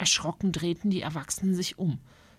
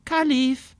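put-on higher, wondering girl trying out the word Kalif; girl trying the name; 7 semitones higher, 4 dB louder than usual. Alexa looks at the bird and tries its name, wondering. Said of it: "Alexa guckte den Nymphensittich an".

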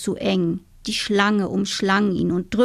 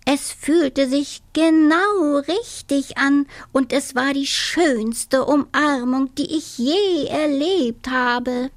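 lightly voiced toad, higher and enraptured; dreamy toad; 5 semitones higher than usual. Toad raves to himself about the hug, higher. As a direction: gush about the perfect hug.

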